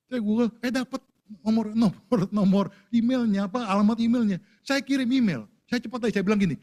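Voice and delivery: high, loud eager rush; tumbling out the discovery; excited retelling. Real, not acted. Preacher recounts finding the CEO email, high and loud with excitement.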